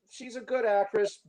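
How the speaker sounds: ordinary delivery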